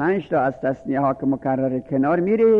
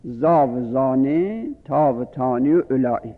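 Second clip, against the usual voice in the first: slow recitation. Shown as a demonstration. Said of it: "همون پنج اصل را".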